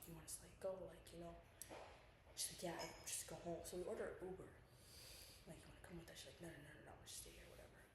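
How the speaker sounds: very low, hushed voice, barely projecting; real low-volume murmur; speaking too quietly. Real, not acted. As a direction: tell it softly under your breath, pitched low. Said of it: "Speak loud".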